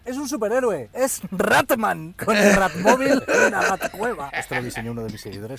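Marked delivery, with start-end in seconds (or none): 0.0-4.3 high, loud, drawn-out billing; mock superhero announcer. Introducing Ratman to viewers, booming mock announcer. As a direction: proclaim the parody hero at full volume.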